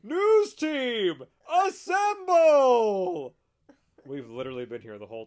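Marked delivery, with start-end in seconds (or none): 0.0-3.3 loud, high, drawn-out mock summons; rallying shout. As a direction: holler it slowly to gather the troops. Film character calls team together.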